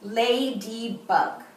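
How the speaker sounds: "Ladybug" slowly in three beats, La, dy, bug; "Ladybug" stretched into three distinct syllables. Shown as a demonstration. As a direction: count out "Ladybug" one syllable at a time. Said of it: "name the insect and count the syllables".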